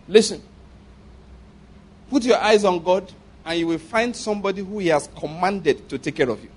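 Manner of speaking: ordinary delivery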